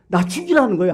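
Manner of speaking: high-pitched mock defiance; defiant talking back. Preacher voicing Jonah talking back to God, defiant. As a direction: challenge with the pitch thrown up. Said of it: "하나님 앞에 대들면서".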